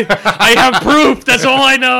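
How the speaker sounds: high loud laughing mimic; laughing mock. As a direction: laugh while mocking the claim. Mocking Greg's claim.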